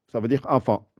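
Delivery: ordinary talk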